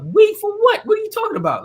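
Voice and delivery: high, disbelieving squeak of a question; baffled high-pitched retort; incredulous. Retold or imitated. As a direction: ask it in high disbelief, as if the idea makes no sense. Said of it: "And I'm like".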